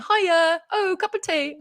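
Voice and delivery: high, bright mock British accent on the plane; mock British "Hiya" with a high lift; mock London accent. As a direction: put on a quick London accent. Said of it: "you adopt an accent".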